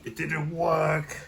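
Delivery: mock wail. The cure fails, said again.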